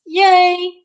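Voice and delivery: high, loud little yay; excited cheer. Real, not acted. Host cheering for animal-loving Iron Horse, high and loud. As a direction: cheer it like a happy fan.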